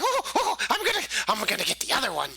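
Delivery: high, fast, gleeful goblin squeal; an eager goblin rushing to attack; excited goblin. Acted. Goblin excited after buddy's hit, squealing high and fast. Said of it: "all excited that his buddy".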